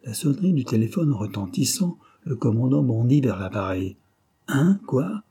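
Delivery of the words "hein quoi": high, sharp shocked questions; startled. A narrator's reading. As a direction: react in disbelief. Commander shocked on phone.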